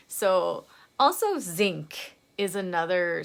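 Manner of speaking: usual speaking voice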